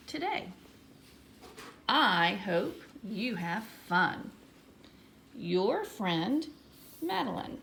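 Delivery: slow, expressive teacher read-aloud; deliberate, drawn-out letter reading with pauses between sentences. Shown as a demonstration. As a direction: read it out word by word with big lilting stresses. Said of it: "let's read my, my letter".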